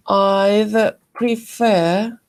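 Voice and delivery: I'd prefer drawn out slowly with a rising end; slow model phrase. Shown as a demonstration. Teacher slowly modelling I'd prefer for students. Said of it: "and as we said".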